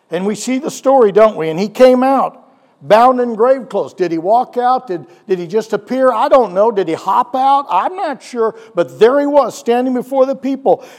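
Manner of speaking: loud, driving sermon delivery; fired-up pastor telling the Lazarus story; preaching at full force. Acted. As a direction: preach it out to the congregation.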